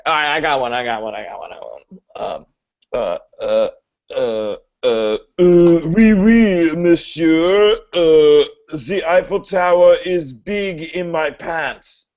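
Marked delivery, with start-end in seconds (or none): 5.4-12.1 put-on slow, comic French accent. Student offering an accent joke to teacher, comic French accent. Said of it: "you told me to do an accent".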